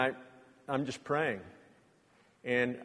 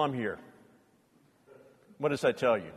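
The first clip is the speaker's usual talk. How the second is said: ordinary talk, nothing marked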